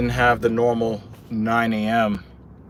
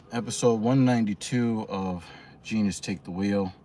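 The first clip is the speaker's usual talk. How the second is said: winded and panting; breathless, still recovering; out of breath; 4 dB quieter than usual. Real, not acted. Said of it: "Still trying to catch my breath".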